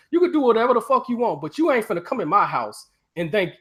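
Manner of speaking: normal talking voice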